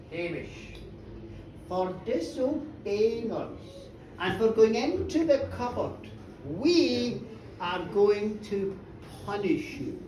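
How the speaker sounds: low, soft, slow pronouncement; hushed, measured threat; ominous old lady. Acted. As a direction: pass sentence slowly and gravely. Old ladies solemnly pronounce sentence.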